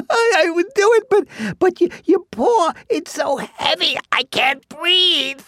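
very high, strained squeaky voice; squashed chipmunk. Acted. Chipmunk complains Bear's paw is heavy, strained squeak. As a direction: squeak out a halting breathless plea.

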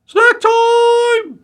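very high, loud squeal; gleeful pig shout. A narrator's reading. Pig pouncing on the woman's basket, squealed war cry. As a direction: shriek it out, stretching the last word.

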